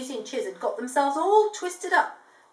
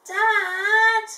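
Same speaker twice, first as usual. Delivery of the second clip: high, loud little chick shouting; a lost chick calling to its father; 12 semitones higher, 8 dB louder than usual. Acted. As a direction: call out like a tiny chick spotting its dad. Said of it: "they heard a voice say".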